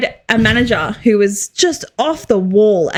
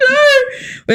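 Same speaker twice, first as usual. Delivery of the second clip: dismayed cry; 13 semitones higher than usual. Retold or imitated. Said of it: "I was like".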